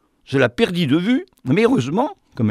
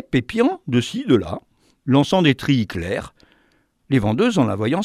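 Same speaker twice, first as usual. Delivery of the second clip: ordinary delivery, nothing marked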